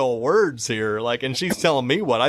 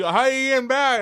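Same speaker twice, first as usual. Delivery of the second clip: higher, louder mock voice of the deaf lady; imitating the deaf lady; 9 semitones higher, 3 dB louder than usual. Teller mimics the deaf lady asking how they're getting back, in a higher, louder voice. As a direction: lightly voice her repeated question.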